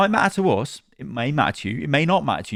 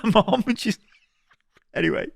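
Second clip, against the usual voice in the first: usual speaking voice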